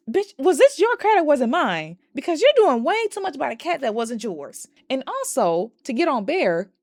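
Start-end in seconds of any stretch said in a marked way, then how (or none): 0.1-4.7 high, loud mock outrage; exasperated telling-off. Viewer scolding Nikki over a cat not hers, exasperated. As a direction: scold in incredulous, raised pitch.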